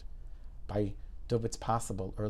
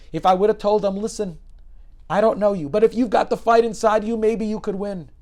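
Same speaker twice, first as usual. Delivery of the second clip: louder and higher, lifting the patient up; hopeful urging; 9 semitones higher, 10 dB louder than usual. Retold or imitated. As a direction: give them a fighting chance. Doctor imagines words of hope.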